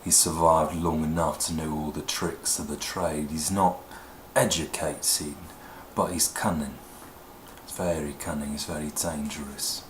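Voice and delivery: low, soft, dangerous British rogue; low-voiced Cockney man; cunning streetwise Cockney. Shown as a demonstration. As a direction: play a world-wise, cunning street survivor, quietly. Accent demo, cunning Cockney type.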